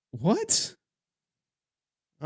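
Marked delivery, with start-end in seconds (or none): 0.1-0.7 high-pitched mock disbelief; incredulous. Viewer reacting to a streamer's sudden sign-off, incredulous. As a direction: shoot up high, baffled.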